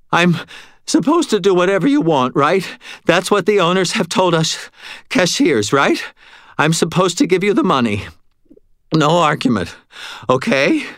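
frantic, near tears; almost sobbing, pleading. A narrator's reading. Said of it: "He was practically sobbing".

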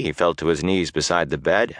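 usual speaking voice

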